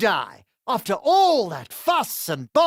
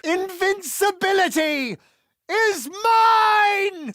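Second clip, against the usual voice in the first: triumphant cry; 7 semitones higher, slower than usual. Acted. Triumphant battle cry in combat.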